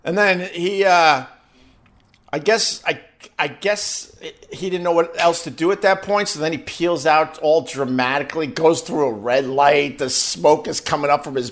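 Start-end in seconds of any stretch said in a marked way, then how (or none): none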